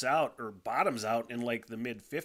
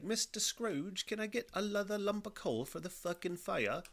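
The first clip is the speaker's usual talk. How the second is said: high, pleading bad British accent; terrible British accent; 8 semitones higher than usual. Worker begging Scrooge for coal, bad British accent. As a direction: beg in a badly faked British accent. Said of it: "My British accent is terrible".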